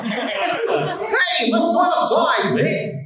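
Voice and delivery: high, shouted Goofy calling across a distance; Goofy hollering to far-off Mickey; calling from afar. Shown as a demonstration. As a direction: yell it to someone fifty feet away. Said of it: "Mickey's fifty feet away from you".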